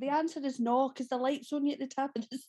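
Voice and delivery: normal talking voice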